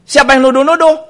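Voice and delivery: high, loud indignant comeback; retold heated protest. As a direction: act out a husband escalating the quarrel. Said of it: "Saya ikut naik".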